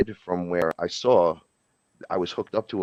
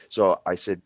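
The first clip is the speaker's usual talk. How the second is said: ordinary talk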